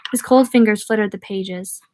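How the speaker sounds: ordinary delivery, nothing marked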